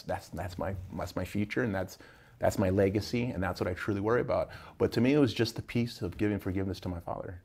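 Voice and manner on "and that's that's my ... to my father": ordinary delivery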